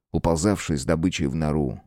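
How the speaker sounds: plain reading voice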